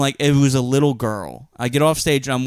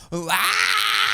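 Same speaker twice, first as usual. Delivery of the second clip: mock crowd scream.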